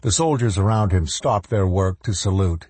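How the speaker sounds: ordinary delivery, nothing marked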